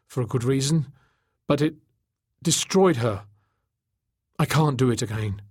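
halting confession, climbing then sinking; Tachyon, anguished. A narrator's reading. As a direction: confess it painfully, phrase by phrase.

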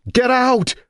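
very high, loud scream; piercing acted shriek. A narrator's reading. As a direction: scream her out of the room. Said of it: "she screamed at her".

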